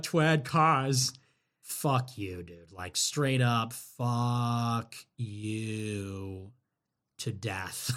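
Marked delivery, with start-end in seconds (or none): 1.6-7.9 contemptuous low. Real, not acted. Scorning a super chat.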